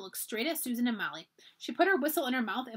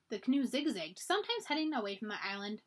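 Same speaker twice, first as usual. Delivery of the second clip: ordinary delivery, nothing marked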